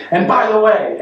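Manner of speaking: high-pitched jump back into mock anger; resuming the fight. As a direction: pick the argument straight back up, pitched high. Snapping back into the acted fight.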